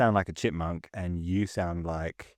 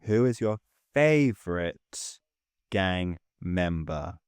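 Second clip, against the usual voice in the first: drawn-out suspenseful ask; slow dramatic question; 3 semitones lower, much slower than usual. Shown as a demonstration. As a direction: stretch it out like a game show host. Dramatic slowed repeat of question.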